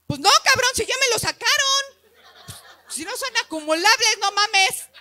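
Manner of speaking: aguda y fuerte, con risa; respuesta indignada en broma. Retold or imitated. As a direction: contesta picada, fingiendo enojo. (indignación de broma, más aguda y fuerte)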